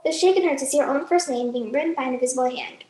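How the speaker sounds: ordinary talk, nothing marked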